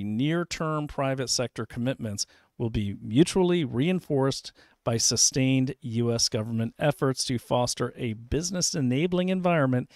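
ordinary delivery

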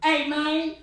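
casual street greeting, lightly voiced; gangster dude. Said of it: "he's like".

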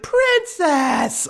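squealed high pet name; high doting squeal. Retold or imitated. Client greets cat, acted quote.

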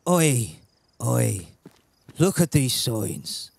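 slow Scottish attempt drifting into Irish; botched Scottish accent. Streamer reading road signs in Scotland, muddled Scottish-Irish accent. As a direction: have a shaky go at Scottish or Irish. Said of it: "you're either getting Irish or Scottish".

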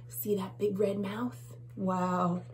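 ordinary delivery, nothing marked

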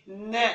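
"ن" with no vowel before it; bare letter sound. Shown as a demonstration. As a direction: sound out "ن", not "en". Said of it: "يبقى صوت الحرف إيه؟".

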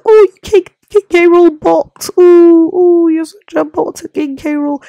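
high, loud mocking whine of a viewer; mocking commenter. Imitating viewers who tease him, high loud mocking. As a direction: squeal it like a jeering fan. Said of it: "everyone's gonna be like".

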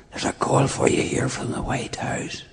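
hushed whisper, no voice at all; whispered announcement. Retold or imitated. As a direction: breathe it out under the hush. Announcing call amid terrible hush.

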